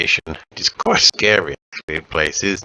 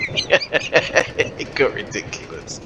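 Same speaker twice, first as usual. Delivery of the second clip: laughing; 7 semitones higher, much slower than usual. Real, not acted. Laughing while telling.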